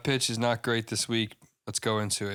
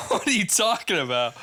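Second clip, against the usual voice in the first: high, loud laughing disbelief; incredulous laughing; 7 semitones higher, 4 dB louder than usual. Retold or imitated. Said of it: "someone commented, was like".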